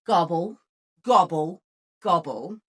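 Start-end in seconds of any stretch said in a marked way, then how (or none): none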